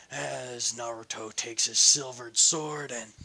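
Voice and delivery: ordinary delivery, nothing marked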